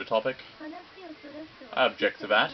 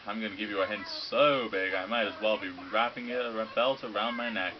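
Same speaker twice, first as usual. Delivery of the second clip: ordinary talk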